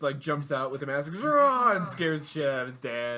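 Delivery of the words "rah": high, loud "rah"; sudden roar to startle someone; jump-scare yell, imitated; mock scare roar.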